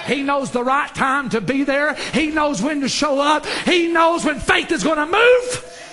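loud, high, building shout; full-force preaching. Real, not acted. Preacher on God arriving at the right time, rising shout. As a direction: climb with each repeated phrase to a stretched finish.

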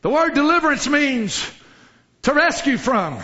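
ordinary talk, nothing marked

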